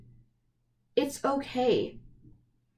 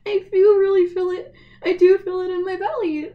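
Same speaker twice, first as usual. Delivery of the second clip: much higher and louder, teary and passionate; emotional outburst; 9 semitones higher, 9 dB louder than usual. Real, not acted. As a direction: burst out with feeling through tears. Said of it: "I've been crying".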